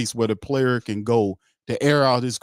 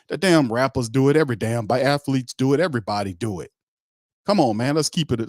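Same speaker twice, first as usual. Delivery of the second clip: usual speaking voice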